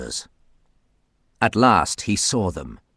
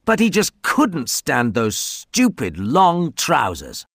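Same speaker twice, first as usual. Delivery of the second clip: usual speaking voice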